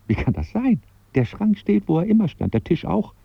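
high, agitated disbelief; baffled protest. Acted. Man who bumped into the cupboard corner, baffled. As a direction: protest that it makes no sense.

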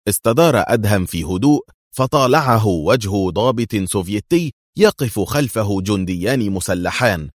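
ordinary delivery

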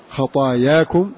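خطاياكم slow, long "aa" after ط and after ي; qadaya pattern. Shown as a demonstration. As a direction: recite خطاياكم as "khataayaakum", drawing the vowels out. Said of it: "على وزن قضايا".

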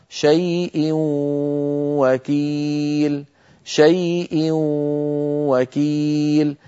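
شيء وكيل بواو مشددة مع غنة طويلة جدا; مطّ الغنة ببطء شديد في وكيل; إدغام التنوين بغنة. Shown as a demonstration. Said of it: "مثال التنوين كما نرى على اللوحة". (واو مشددة مصحوبة بغنة)

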